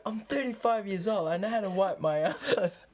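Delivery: a touch of outraged man; mock indignant. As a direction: act offended like a grown man. Indignant guy quoted.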